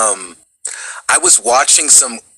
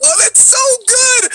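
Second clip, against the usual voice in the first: delighted squeal; slower than usual. Retold or imitated. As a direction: squeal like a thrilled fan. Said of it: "I was like".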